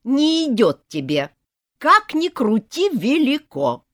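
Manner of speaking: put-on loud, high nagging old woman; grandma haggling for ring; scolding granny. A narrator's reading. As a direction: insist it doesn't suit her.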